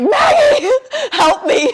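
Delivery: high, loud wailing cry for help; panicked plea. Retold or imitated. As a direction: mock-desperate wail with a laugh breaking in. Grabbing friend in retold panic.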